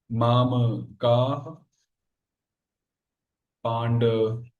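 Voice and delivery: low, very slow Sanskrit recitation with long gaps; slow verse dictation. Shown as a demonstration. Teacher reciting the Gita's first shloka to students, slow dictation. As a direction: dictate the verse word by word, drawn out. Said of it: "पहला श्लोक है गीता का".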